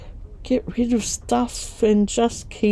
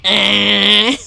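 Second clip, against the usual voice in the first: put-on loud laughing baby "Ah"; mock toddler yell with a laugh; toddler shout; 6 dB louder than usual. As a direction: blurt it like a toddler dodging his name. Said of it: "he went".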